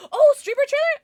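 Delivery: high, loud excited squeal; delighted surprise at a chat request; bright jump of surprise; surprised. Real, not acted. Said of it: "reacting to a chat message".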